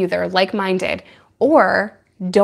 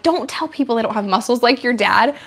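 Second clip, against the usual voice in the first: ordinary delivery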